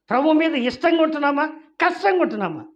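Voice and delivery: preacher rising to a loud rebuke; fiery preaching. Acted. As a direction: build the rebuke up to a shout. Preacher challenging congregation.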